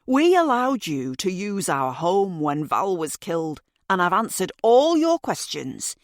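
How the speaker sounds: lightly voiced resentful man; a hint of a grudging older man; irritated man voice. Narrator voices Mr Green.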